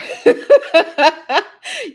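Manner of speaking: high, loud peals of laughter; hearty laugh. Real, not acted. Remembering life before nine eleven, laughing hard. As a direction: burst out laughing, pitch way up. Cracking up over pre-9/11 days.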